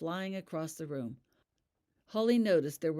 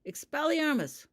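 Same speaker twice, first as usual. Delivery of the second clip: loud, higher, slow incantation; cast spell; 11 semitones higher, 6 dB louder than usual. A narrator's reading. Sean casting at Holly, loud spell. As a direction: call the spell out strongly. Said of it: "Sean reluctantly made his own move".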